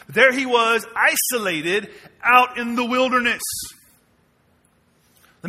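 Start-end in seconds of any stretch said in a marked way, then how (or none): none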